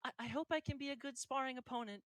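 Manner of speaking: put-on high, shy girl voice, stammered; bow deeply and speak up shyly; timid girl. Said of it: "bows extremely deeply and says".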